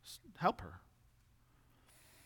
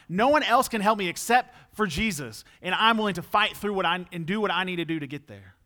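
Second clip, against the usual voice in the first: loud, raised declaration of faith; confident; 4 semitones higher, 7 dB louder than usual. Acted. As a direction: voice her determination with force, easing slightly at the end. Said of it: "that same confidence as this woman".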